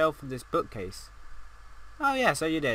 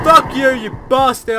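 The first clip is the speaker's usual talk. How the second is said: loud, high cursing after a scare; startled angry shout; 6 semitones higher, 10 dB louder than usual. Real, not acted. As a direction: curse at whatever scared you. Curses after jump scare.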